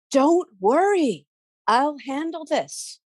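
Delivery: calm them gently; reassuring Bridget. A narrator's reading.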